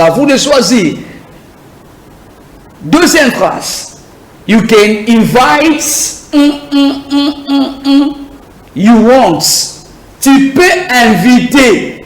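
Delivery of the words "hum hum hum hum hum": short high hums on one note; steady high hums for a missing word; placeholder hum. Shown as a demonstration. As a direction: hum out the gap, evenly. Hums to stand for the blank.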